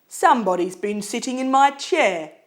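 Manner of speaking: loud, growly Papa Bear. A narrator's reading. Papa Bear finds his chair moved, loud and growly. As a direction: growl it out loudly as a big bear. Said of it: "in his loud growly voice".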